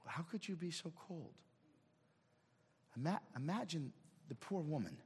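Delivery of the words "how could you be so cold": ordinary delivery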